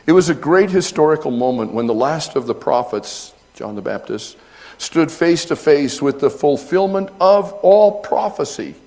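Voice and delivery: loud, high proclaiming preacher; preaching at full force. Acted. Pastor reading to his church, full force. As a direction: build it up to a great moment.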